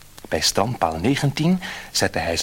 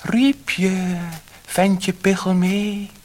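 high, loud little fish voice; a tiny magic fish answering from the sea; slow, high silvery fish voice; 10 semitones higher, 4 dB louder, much slower than usual. A narrator's reading. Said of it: "weer klonk het zilveren stemmetje".

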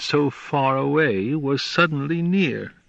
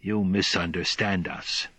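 human-like correction; 4 semitones lower, 6 dB quieter than usual. Acted. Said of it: "in human fashion".

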